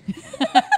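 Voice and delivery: sudden high-pitched laugh; high surprised laugh. Real, not acted. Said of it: "never heard that laugh before".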